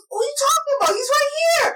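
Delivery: retold grandfather, high and baffled; incredulous grandfather. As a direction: act the puzzled grandfather insisting. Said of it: "he goes".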